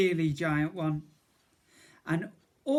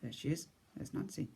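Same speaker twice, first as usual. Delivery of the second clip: ordinary delivery, nothing marked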